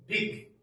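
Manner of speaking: Big said high with a short i. Shown as a demonstration. Teacher modelling big for the class. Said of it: "so I'll say what".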